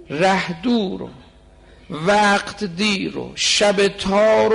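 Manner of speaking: mournful intoned prayer, voice changed; lamenting recitation. Acted. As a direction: cry the verse out like a plea for help. Full recital of the couplet.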